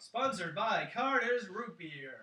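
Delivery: loud, drawn-out announcer voice; mock sponsor read. Podcast host spoofing a root beer sponsor, mock announcer. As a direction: belt out a hammed-up ad slogan.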